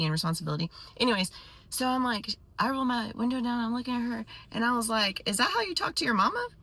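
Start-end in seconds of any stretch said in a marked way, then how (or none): 5.3-6.5 high, quick scandalized question; mock incredulous challenge. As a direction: call her out in high-pitched disbelief. Acted confrontation of the yelling woman.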